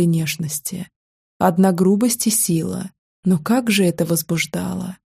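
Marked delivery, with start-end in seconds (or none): none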